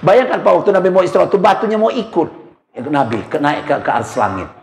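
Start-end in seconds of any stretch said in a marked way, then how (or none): none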